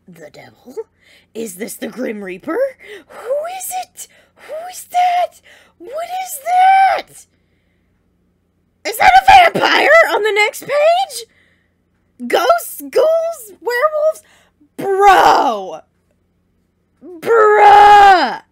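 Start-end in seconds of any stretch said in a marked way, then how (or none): 3.1-12.3 high squealing questions, building louder; frantic excitement. Real, not acted. Frantic guessing at mystery figure. 12.3-14.2 high, loud squealed guesses; frantic excitement. Real, not acted. Frantic guessing at next monsters. 14.8-18.4 loud, drawn-out excited holler; overwhelmed shout. Real, not acted. Overwhelmed by the reveal.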